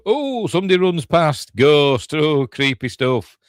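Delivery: loud, high, hammed-up ghostly oohs; mock spooked. Narrator reacting to a ghost running past, mock spooked and loud. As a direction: wail it out like playful haunted-house commentary.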